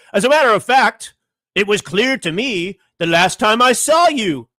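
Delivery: lightly mocking, drawn-out put-on voice; hammed-up Jeffrey voice. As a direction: read Jeffrey's reply as a mock character. Voicing Jeffrey in birthday letter.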